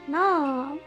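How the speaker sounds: ordinary delivery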